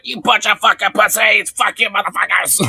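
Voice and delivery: mock-shout the insults at the crowd; mock yell. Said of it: "Start yelling at 'em, too, just like this".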